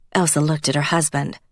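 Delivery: usual speaking voice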